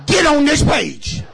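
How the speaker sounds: ordinary talk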